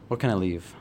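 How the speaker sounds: usual speaking voice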